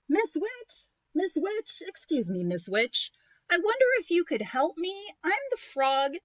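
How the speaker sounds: high, loud calling. Acted. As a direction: call out politely to the witch. Prince calling to witch.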